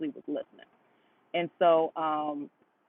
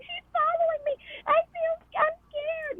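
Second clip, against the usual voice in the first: much higher, mocking imitation of a frightened caller; mock scared lady; slower than usual. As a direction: squeak it out like a fake-scared woman on the phone. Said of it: "She's like".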